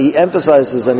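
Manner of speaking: usual speaking voice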